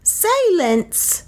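high-pitched priest calling for silence; commanding cry. A narrator's reading. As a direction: cry it out high over the crowd. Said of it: "cried Nam".

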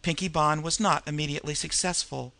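plain reading voice

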